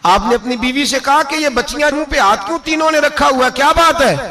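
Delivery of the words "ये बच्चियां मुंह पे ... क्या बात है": a husband's worried question to his wife; puzzled concern. Retold or imitated. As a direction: demand what is going on. Caliph asks wife about daughters.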